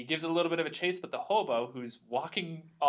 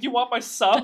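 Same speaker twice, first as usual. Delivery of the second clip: high, loud desperate offer; hammed-up cabbie bargaining for mercy; mock begging; 8 semitones higher, 8 dB louder than usual. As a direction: plead with pitch and volume up. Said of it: "begging for him to stop".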